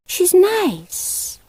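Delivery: put-on high, light child's voice; sweet young girl sticking up for the cat; little girl Debbie. A narrator's reading. Debbie defends Mog to her father, in a high child's voice. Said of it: "But Debbie said".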